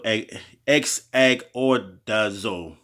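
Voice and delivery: exagordazo stretched out slowly, syllable by syllable. Shown as a demonstration. Teaching the Greek word exagordazo slowly to listeners. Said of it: "let me say it slow".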